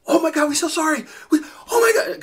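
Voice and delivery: high, loud mock wail; hammed-up horrified drivers apologising; mortified apology. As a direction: squeal an embarrassed apology. Mortified drivers apologising after explanation.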